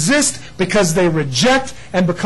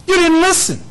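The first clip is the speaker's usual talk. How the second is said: high, loud rebuke; rebuking; 12 semitones higher, 4 dB louder than usual. Pretend. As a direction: cry out the accusation, much higher and louder. God rebuking Israel repeatedly.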